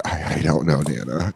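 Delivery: acted low, embarrassed stammer; sheepish. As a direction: answer small and defeated, as to a grandmother. Acted sheepish answer to grandmother.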